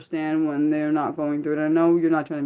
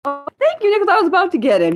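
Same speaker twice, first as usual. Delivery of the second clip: excited thanks; 11 semitones higher, 6 dB louder, faster than usual. Real, not acted. Thanking a moderator.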